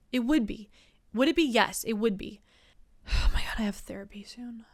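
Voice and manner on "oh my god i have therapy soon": hushed whisper aside. Real, not acted. Dreading therapy, whispered aside to herself. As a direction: drop to a breathy whisper. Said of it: "whispers aside".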